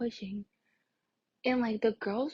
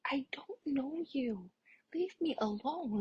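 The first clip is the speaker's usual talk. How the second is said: slow, higher, fed-up retort; exasperated rebuff; 4 semitones higher, 3 dB quieter, slower than usual. Retold or imitated. Girl retelling brushing off a pushy boy, slow exasperation. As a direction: drag it out in weary disbelief.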